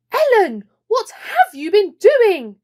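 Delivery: loud, high scolding call; a mum who just saw the mess; cross mum. Acted. Mum catching Ellen in the soaked bathroom, loud scolding.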